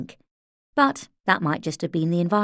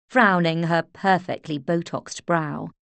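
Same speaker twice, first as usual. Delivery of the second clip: ordinary delivery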